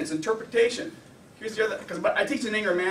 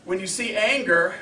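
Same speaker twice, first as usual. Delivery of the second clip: ordinary talk, nothing marked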